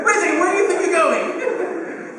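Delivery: high, loud, laughing mock alarm; startled parent. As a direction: call after the kid in disbelief. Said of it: "father reacts to kid leaving".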